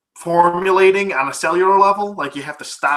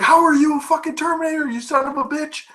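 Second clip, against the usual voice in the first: much higher, fast, mock-outraged voice; outraged demand; 12 semitones higher, faster than usual. Heroes confronting John about being a Terminator, high outraged demand.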